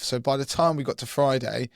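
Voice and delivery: usual speaking voice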